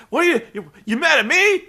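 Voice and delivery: high, loud, hammed-up pleading; Ric Flair begging off. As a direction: plead your innocence like a cowardly showboat. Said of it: "Rick starts begging off".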